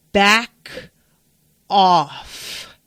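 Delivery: two separate, forceful words; confrontational warning. Retold or imitated. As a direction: imitate a firm, punchy threat. Claire leans forward, warning Jenny.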